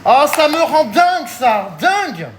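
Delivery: high, loud exasperated outburst; real frustrated cry. Real, not acted. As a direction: blow up at the sticky mess. Exasperated about sticky bag.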